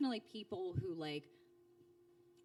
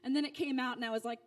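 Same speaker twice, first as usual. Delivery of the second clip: usual speaking voice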